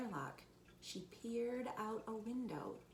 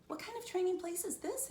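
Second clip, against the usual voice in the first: put-on higher-pitched, curious child's question; lightly voiced puzzled kid; 10 semitones higher, 3 dB louder than usual. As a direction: ask it high and wondering. Puzzled child asks in story.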